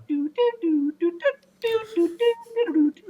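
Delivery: very high imitated phone alarm tune; happy alarm jingle. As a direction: toot out a cheery little electronic jingle. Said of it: "plays this happy little sound".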